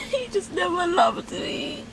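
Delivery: real sobbing, high strained voice; high-pitched, tearful self-reproach; crying. Real, not acted. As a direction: cry through the words slowly, sobs breaking in between. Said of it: "I can't believe I cried again".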